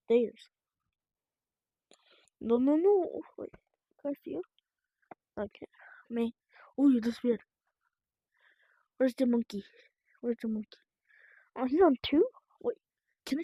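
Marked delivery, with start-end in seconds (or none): none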